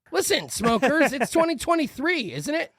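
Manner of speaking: high-pitched mock lecture to smokers; mock scolding. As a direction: needle them with pointed, high-pitched questions.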